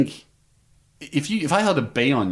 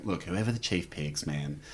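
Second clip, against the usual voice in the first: hushed scheming; 7 semitones lower, 11 dB quieter than usual. Retold or imitated. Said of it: "It's got me and Andy going".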